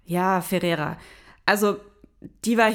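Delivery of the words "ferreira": ordinary delivery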